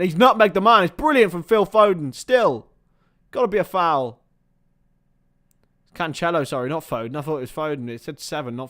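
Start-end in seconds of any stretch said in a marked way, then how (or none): 0.0-4.2 high, loud excited match commentary; urgent football commentary at full voice; live play-by-play. Real, not acted. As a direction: call the chance as it happens, voice raised. Live play-by-play of Foden's run.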